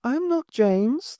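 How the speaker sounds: put-on a dead girl speaking through the boy; slow, high ghostly child voice; eerie girl's voice. A narrator's reading. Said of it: "the boy said".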